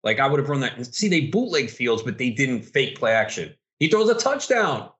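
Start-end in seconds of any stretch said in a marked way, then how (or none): 3.8-4.9 high-pitched shout of disbelief; incredulous outcry. Real, not acted. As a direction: yell at the screen, stunned. Disbelief at a touchdown throw.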